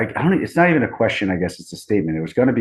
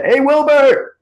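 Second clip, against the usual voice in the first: excited greeting; 7 dB louder, much slower than usual. Real, not acted. High, delighted call-out.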